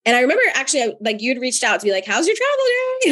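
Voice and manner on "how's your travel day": much higher, bright sing-song check-in; chirpy friend. Retold or imitated. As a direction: mimic a cheery friend asking sweetly. Imitating friend's cheerful text.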